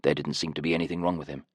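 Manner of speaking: ordinary delivery, nothing marked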